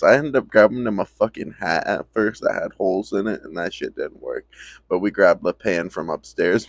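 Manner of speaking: ordinary talk, nothing marked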